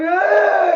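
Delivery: high imitated cry; mock disgusted yell. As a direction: yelp as the smell hits. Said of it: "I'm like".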